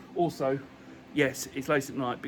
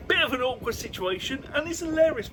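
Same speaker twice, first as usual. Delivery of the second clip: amused; 10 semitones higher than usual. Real, not acted. Amused retelling.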